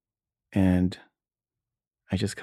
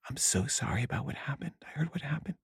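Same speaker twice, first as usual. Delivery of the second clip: soft, higher-pitched sympathy; hushed condolences; 5 semitones higher, 12 dB quieter than usual. Retold or imitated. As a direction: imitate schoolmates gently offering condolences. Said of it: "people giving me their condolences".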